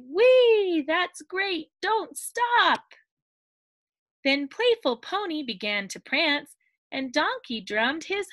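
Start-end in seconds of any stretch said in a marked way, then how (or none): 0.1-3.0 high, loud gleeful shout; thrilled squeal of joy; excited frog cry. A narrator's reading. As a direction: cheer them on like a delighted frog. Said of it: "Frog cried".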